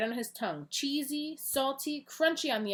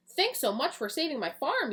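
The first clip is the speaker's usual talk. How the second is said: higher, bright thanks; grateful exclaim; 6 semitones higher than usual. Acted. Miss Green thanking Eagle Boy, excited. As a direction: gush your thanks to the hero. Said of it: "Miss Green exclaimed".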